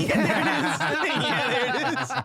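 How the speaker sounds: high, laughing through the words; cracking up at the joke. Real, not acted.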